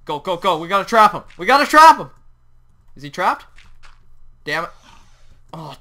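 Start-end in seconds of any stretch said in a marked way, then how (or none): 0.1-1.2 loud, urgent push; pressing, raised voice; urgent call. Real, not acted. Urgent chase of the tiger. 1.4-2.1 high, very loud urgent yell; frantic high-pitched insistence; frantic shout. Real, not acted. Repeats it more frantically.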